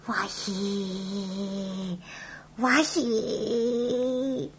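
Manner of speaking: straining quietly. Acted. Told to concentrate for bow.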